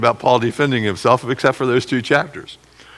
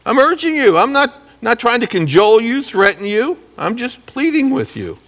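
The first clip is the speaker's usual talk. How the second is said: much higher earnest pleading voice; Paul pleading; 9 semitones higher than usual. Retold or imitated. Paul answering his accusers, pleading much higher. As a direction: beg them gently as Paul would.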